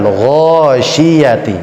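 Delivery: GHASYIYATI opening on a heavy "gho"; slow ghasyiyati with a rounded first vowel; thick gha. Shown as a demonstration. Teacher drilling an example, thick Ghasyiyati. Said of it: "Gha tebal".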